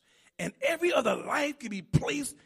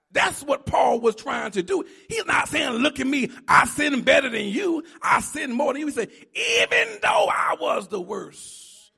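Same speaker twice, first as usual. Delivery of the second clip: loud, driving preacher's cadence; fervent sermon shout; full-force preaching; 5 semitones higher, 10 dB louder than usual. Acted. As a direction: hammer it home to the congregation. Preaching peak, quoting Paul loudly.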